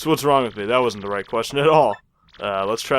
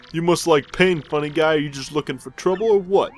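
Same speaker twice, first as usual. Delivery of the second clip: louder, raised tough-guy voice; hammed-up mobster menace; threatening mobster goon; 5 semitones higher, 4 dB louder than usual.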